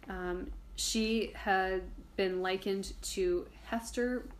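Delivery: normal talking voice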